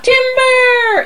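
high, drawn-out "timber" holler; mock lumberjack call. Joking that her interest is gone, high drawn-out call. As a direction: sing out a mock tree-felling warning.

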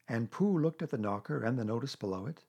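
ordinary delivery, nothing marked

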